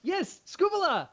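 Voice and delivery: scubala sung out high and slow; high playful word. Shown as a demonstration. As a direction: lift scubala high and stretch it. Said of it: "fun word in the Bible".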